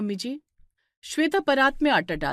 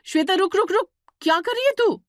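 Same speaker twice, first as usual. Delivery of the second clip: high, loud panicked shout to stop her; sister-in-law shouting in alarm over kerosene; 10 semitones higher, 5 dB louder than usual. Acted. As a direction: yell to stop her before disaster. Divya stops kerosene being poured.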